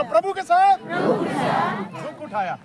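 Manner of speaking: shouted slowly, each word stretched; loud slow preaching shout; loud drawn-out proclamation. Shown as a demonstration. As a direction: call it out loud and long. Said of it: "जोर से बोलो".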